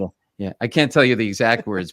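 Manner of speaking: ordinary delivery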